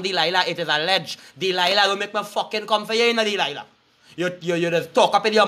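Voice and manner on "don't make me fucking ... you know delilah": ordinary delivery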